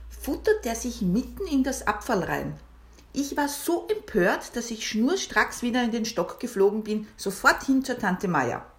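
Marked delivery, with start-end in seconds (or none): none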